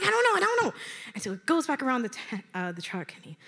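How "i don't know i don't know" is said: high mock-panicked answer; flustered. Asked where the keys are, flustered. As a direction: blurt it out, pitch climbing.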